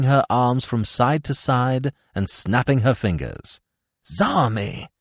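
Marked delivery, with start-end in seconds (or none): 4.0-4.9 mild exclamation of recognition. A narrator's reading. Smith recognizes the dancer, exclaiming mildly. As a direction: exclaim the name without shouting. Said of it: "exclaimed Smith".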